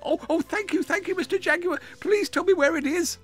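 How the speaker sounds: very high, excited little hedgehog; joyful squeak. A narrator's reading. Young hedgehog thanking the jaguar, squeaking with joy. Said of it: "squeaked with joy".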